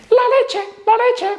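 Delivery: very high, small voice; high little voice. Shown as a demonstration. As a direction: chirp it in a tiny high voice. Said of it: "la petite voix beaucoup plus aiguë".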